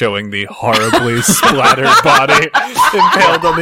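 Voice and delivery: loud, laughing narration. Real, not acted. Player zooms the camera out on the body, laughing. Laughing while describing a gory shot.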